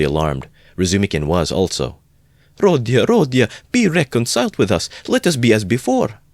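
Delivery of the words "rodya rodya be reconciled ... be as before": high, raised-voice plea; pleading cry. A narrator's reading. Mother begging her departing son to reconcile, crying out. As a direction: cry out to him, high and imploring. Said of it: "cried his poor mother".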